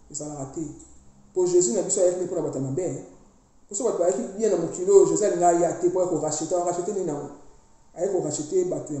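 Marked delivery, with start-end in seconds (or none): none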